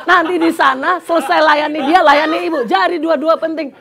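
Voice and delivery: loud, high, laughing through the words; laughing urgently. Retold or imitated. Speaker urging both to come along, loud nervous laughter. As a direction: push it out, half laughing in alarm. Retelling frantic compromise offer.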